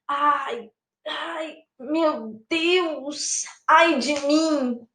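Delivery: soft, aching moan, slowing at the end; acted woeful lament; in pain. Shown as a demonstration. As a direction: groan softly as if hurting. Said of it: "Uma dor".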